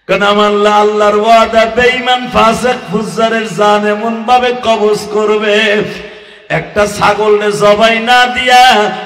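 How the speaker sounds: loud, high, chanted sermon storytelling; impassioned high-volume preaching chant; full-force preach. Real, not acted. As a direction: belt it out in a sing-song cry, holding the phrase endings.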